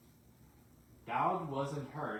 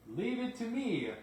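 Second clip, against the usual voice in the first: high, louder confident shout; 11 semitones higher, 5 dB louder than usual. Acted. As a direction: call out to take charge. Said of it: "shouted Douglas".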